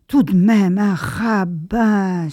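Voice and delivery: a slowed, stretched-out un rabbin; indignant cry. A narrator's reading. Young woman, ashamed, crying out to the lieutenant. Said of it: "s'écria la jeune femme".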